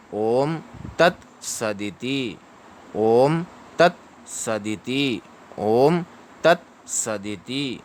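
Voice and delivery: slow, measured "ओम तत् सदिति" with gaps between words; syllable-by-syllable demonstration. Shown as a demonstration. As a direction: model "ओम तत् सदिति" for repetition, drawing out "ओम". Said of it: "उसके बाद में बोलिए तत्".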